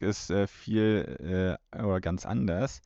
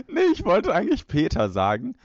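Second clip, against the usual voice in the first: laughing; 11 semitones higher, 7 dB louder than usual. Real, not acted. Laughs at own slip.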